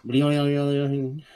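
silly high squeaky creature bleat; Choo Choo noise. Retold or imitated. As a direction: imitate a goofy toy-train critter sound. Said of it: "the Choo Choos are like".

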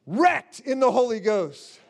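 normal talking voice

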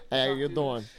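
put-on light Boston accent greeting; casual Boston accent, laughing through it. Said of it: "I did a Boston accent".

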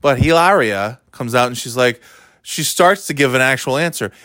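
ordinary talk, nothing marked

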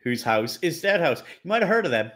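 usual speaking voice

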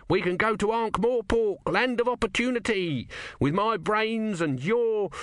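the tortoise god shouting after Brutha, high and urgent; Om pleading. Acted. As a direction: plead frantically after him. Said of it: "try shouting his own mind".

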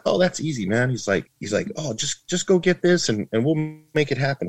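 pitched high and airy, casual dad; dad's easygoing voice. Retold or imitated. Dad brushing off his son's car trouble, high and airy. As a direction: toss it off like it's nothing.

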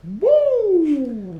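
excited whoop. Real, not acted. Hyped from triple shot.

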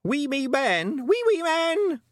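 put-on very high, drawn-out toddler squeal; gleeful little boy chanting; gleeful shout. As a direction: shriek it with a small child's glee. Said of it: "shouted Wentworth gleefully".

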